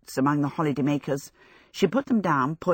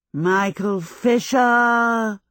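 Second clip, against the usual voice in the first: slow, louder, booming as if from a box; witch voice from a box; 4 semitones higher, 4 dB louder, much slower than usual. A narrator's reading. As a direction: call out his name like a menacing witch. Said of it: "enlarged and muffled, as if it were speaking in a box".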